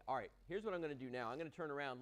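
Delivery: ordinary delivery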